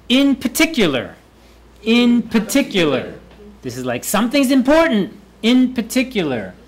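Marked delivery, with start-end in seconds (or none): 0.1-3.1 much higher, "In" punched, falling through "particular"; sound big. Shown as a demonstration. Teacher highlights an important point, sounding big. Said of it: "making them sound big". 4.0-6.5 high, emphatic flag on "important" and "in"; sound big. Shown as a demonstration. Teacher shows how to highlight a point, emphatically. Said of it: "making them sound big".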